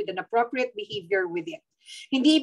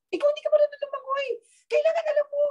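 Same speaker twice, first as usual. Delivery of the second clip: mock teasing; faster than usual. Teasing about a song lyric.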